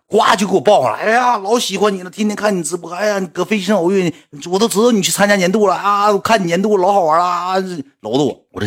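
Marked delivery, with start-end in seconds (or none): 1.0-7.6 high, eager fan voice; gushing fan. Retold or imitated. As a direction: gush like a starstruck fan. Fan gushing while hugging him.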